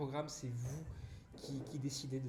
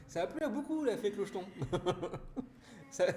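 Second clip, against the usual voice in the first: high, giggly delivery through laughter; much higher, amused laughing line; 6 semitones higher than usual. Real, not acted. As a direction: squeak it out while cracking up. Laughing about fairy character.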